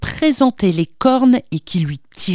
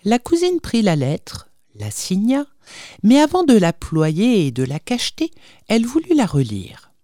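usual speaking voice

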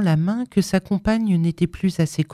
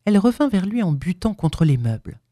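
usual speaking voice

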